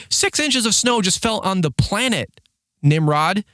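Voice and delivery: slow, high, exasperated mock yell; retold shouting match. Driver answers complainer in shouting match, slow high mock yell. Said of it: "it's just a shouting match".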